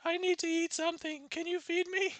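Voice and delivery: high, far-off put-on grandma voice; wolf grandma. Wolf disguised as grandma begging food, high granny voice. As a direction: play the wolf posing as sweet granny.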